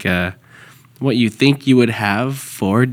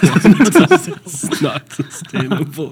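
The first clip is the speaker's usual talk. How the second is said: slow, laughing echo of a friend; laughing quote; slower than usual. Retold or imitated. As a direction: repeat it slowly through laughter. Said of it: "he said".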